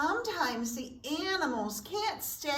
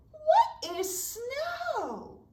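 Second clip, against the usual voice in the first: squeaky squirrel; 12 semitones higher, much slower than usual. Acted. Squirrel asks Deer in story.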